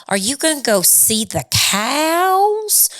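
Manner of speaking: put-on high little-kid Southern drawl; Southern accent. Mom quoting her little boy on video, Southern accent. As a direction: imitate a small boy's Southern question, voice climbing high on cows. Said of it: "a little bit of a Southern accent".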